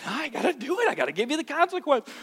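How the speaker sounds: high-pitched, pained reluctance; acted parent giving in to duty; reluctant parent. Parent forced to give consequence, pained reluctance. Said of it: "you don't wanna do it".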